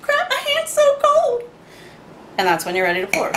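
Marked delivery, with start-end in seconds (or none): 0.0-1.4 high, slow, drawn-out put-on whine; mock freezing complaint. Vlogger acting out over-shaking the cocktail, high mock complaint. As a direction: squeal it slowly in a silly high voice. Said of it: "you're like".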